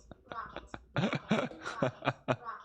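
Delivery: long, high wheezing laugh; breathless, voiceless laughing fit; real laugh. Real, not acted. Breaks into laughter after "here we go.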